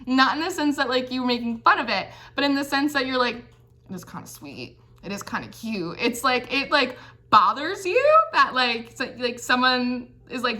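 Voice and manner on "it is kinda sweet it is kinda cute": much softer, half to yourself; grudging quiet admission. Pretend. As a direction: admit it under your breath, a little charmed. Secretly charmed, admitting it quietly.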